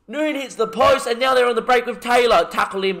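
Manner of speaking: high, loud urgent calls; tense commentary. Real, not acted. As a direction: shout them back on defence.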